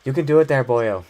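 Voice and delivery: put-on a playful stab at an Irish brogue on boyo; Irish accent. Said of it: "let me try one more".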